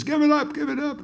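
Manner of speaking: mock announcer yelling high and loud; hammed-up announcer. Mock announcer hyping the crowd for an encore, shouting high. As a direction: ham up a pushy announcer hollering at the crowd. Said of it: "some asshole announcer that says".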